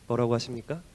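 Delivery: ordinary talk, nothing marked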